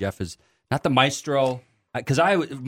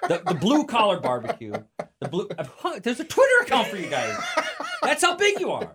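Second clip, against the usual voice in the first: much higher, laughing through the words; 9 semitones higher than usual. Real, not acted. Host teasing the guest while laughing. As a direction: giggle your way through it.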